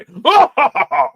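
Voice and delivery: loud, high hammed-up evil cackle; over-the-top mock laugh; villain laugh.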